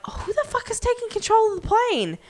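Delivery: disbelieving high-pitched shout; shocked high cry. Retold or imitated. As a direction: squeak out the alarm. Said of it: "you're like".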